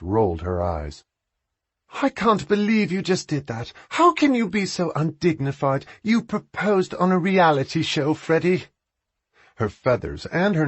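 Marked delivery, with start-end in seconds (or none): 2.0-8.7 exasperated scolding. A narrator's reading. Sister scolding undignified brother.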